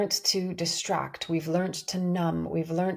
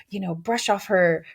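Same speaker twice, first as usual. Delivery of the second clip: usual speaking voice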